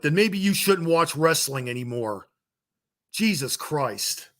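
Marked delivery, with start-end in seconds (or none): 3.1-4.3 exasperated. Real, not acted. Exasperated closing sigh.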